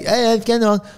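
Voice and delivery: high careless yes-yes; mock boy. Boy brushing off his dad's warning, high and careless. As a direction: brush off dad like a teen. Mimicking boy's reply.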